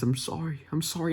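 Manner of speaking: imitated cracking voice. Fan retelling Obi-Wan's apology to Anakin, imitated cracking voice. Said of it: "his voice cracks as he says".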